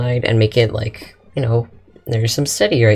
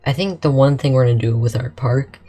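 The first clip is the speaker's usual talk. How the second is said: usual speaking voice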